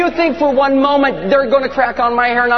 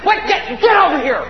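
shouted order; 6 semitones higher than usual. Acted. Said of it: "He's like".

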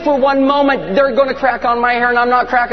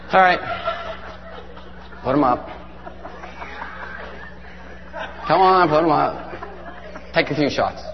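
low, unimpressed invitation to fight; bored challenge; 7 semitones lower, 7 dB quieter than usual. Acted. Jesus tells Satan to put them up, bored challenge. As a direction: offer the fight wearily, in a low voice. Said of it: "he's like".

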